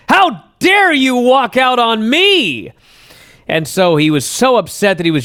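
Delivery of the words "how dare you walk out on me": high, slow, indignant accusation; mock indignant fan. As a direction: throw the fans' outrage at him. Voicing angry fans' accusation.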